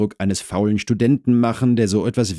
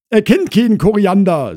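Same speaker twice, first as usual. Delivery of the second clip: high, loud housewife voice; Frau Krause relaying; 14 semitones higher, 9 dB louder than usual. Acted. Wife interprets husband's answer for neighbour, high and loud. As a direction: report back at high pitch.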